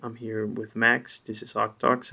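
ordinary talk

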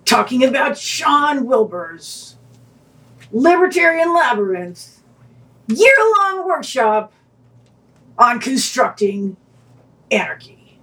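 slow, booming monster truck announcer. Acted. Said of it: "I don't know why you're doing a monster truck voice".

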